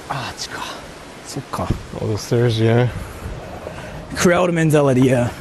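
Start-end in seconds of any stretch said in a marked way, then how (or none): 0.1-2.9 breathy whispered mutter; whispered, half to self; whispered aside. Real, not acted. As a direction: drop to a whisper.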